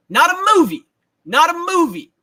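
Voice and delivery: high, loud mocking rant; loud incredulous quoting rant; Allen Iverson rant. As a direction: bark it like the famous press conference. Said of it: "the new Allen Iverson".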